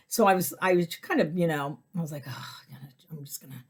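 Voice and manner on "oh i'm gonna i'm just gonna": whispered thought. Retold or imitated. Inner thought voiced in whisper.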